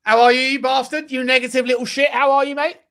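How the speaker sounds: loud, high, laughing welcome; excited laughing greeting. Real, not acted. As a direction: greet a mate with a laugh and a jab. Greeting friend joining stream, laughing.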